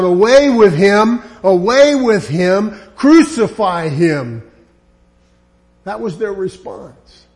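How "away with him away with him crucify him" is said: louder, chanting mob cry; crowd's cry. Retold or imitated. Crowd demanding Jesus be crucified, louder mob cry. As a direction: shout it like the angry crowd.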